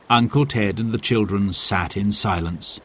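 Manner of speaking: ordinary delivery, nothing marked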